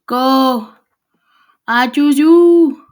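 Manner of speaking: loud, drawn-out mock-heroic shout; battle call. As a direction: holler it like sending out your champion. Calling out a battle cry.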